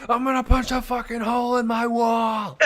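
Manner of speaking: high, shouted imitation of a raging gamer; hammed-up furious yell; mock rage. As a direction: wail it like a friend losing at games. Imitating the friend who rages.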